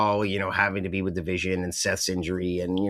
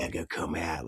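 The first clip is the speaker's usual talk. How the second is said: quiet, much softer, wary; hushed slow line; 6 semitones lower, 8 dB quieter than usual. Retold or imitated. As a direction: keep it low like hiding in the trees. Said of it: "he's talking real slow".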